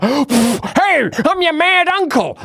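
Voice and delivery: mock mad-uncle voice, rising high; hammed-up impression of an over-eager uncle; Tim Walz. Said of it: "think of with Tim Walz. He's like".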